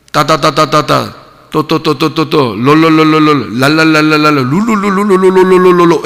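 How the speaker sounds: mock speaking in tongues with rattled nonsense syllables; mock tongues babble. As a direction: parody glossolalia with rolling la-la and ddo-ddo sounds. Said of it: "일어나자마자". (방언 흉내, 랄랄라 롤롤롤 소리)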